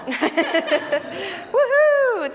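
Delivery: loud, laughing cheer; cheering woohoo. Pretend. Puppet cheering as the children leave, laughing. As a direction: whoop it out with a laugh.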